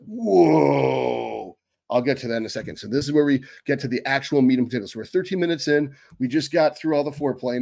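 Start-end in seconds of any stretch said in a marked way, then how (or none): 0.0-1.6 long drawn-out mock "whoa", sliding down; mock overwhelmed whoa. Trainer imitating a viewer faced with the workout split, mock overwhelmed. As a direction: imitate a stunned listener with one stretched exclamation.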